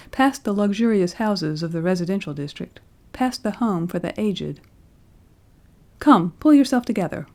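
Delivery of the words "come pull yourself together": louder, higher firm order; commanding. A narrator's reading. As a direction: snap him out of it. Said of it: "commanded the nurse".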